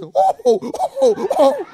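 high, loud shocked gasps; flustered church lady. Retold or imitated. Scandalized lady in line reacts, high shocked gasps.

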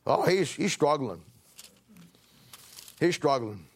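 ordinary talk

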